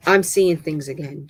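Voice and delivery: ordinary delivery, nothing marked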